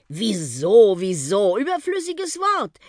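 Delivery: drawn-out, dismissive echo of the question; exaggerated, swooping mock wonder. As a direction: mimic the boy's question, then brush it off airily. Bus mockingly echoes the boy's question.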